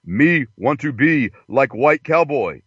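halting, slow broken English; joke caricature. Acted. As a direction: put on a caricature, phrase by phrase. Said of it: "He goes".

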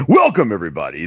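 mock DJ bass; deep radio welcome. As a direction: open the show in the put-on radio bass. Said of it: "You gotta have bass in the voice".

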